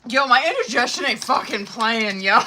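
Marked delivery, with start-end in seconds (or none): none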